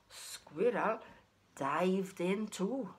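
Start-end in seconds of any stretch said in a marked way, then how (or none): none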